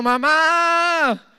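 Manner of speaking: very high, loud tattletale call; girl calling to her mother about her brother; tattling child. Retold or imitated. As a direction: run to mom and complain. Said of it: "decía".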